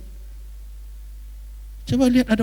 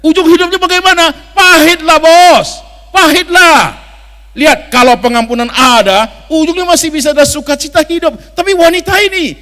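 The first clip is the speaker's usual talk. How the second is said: loud, high, ringing pulpit voice; impassioned sermon, voice raised high; preaching at full force; 9 semitones higher, 13 dB louder than usual. Acted. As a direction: preach it out to the whole church.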